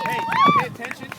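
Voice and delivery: high, loud call for attention. Real, not acted. Calling the team to attention.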